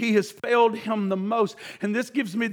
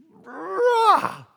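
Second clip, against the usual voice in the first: short strangled high outburst; sudden broken wail; anguished cry; 8 semitones higher than usual. Acted. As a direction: Peter collapsing in grief. Peter breaks down.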